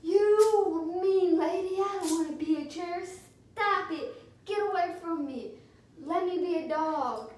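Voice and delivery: loud, high, strained screech of a furious chair; shrieking chair yelling at the lady to back off; chair screaming. Retold or imitated. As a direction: scream it at her like a cornered piece of furniture. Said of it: "the chair screamed at her".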